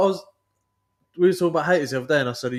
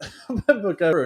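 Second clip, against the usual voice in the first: normal talking voice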